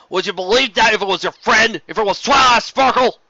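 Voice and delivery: loud hateful retort; hateful challenge. A narrator's reading. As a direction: throw the question back at her with hatred. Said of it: "the hatred was gone from his words".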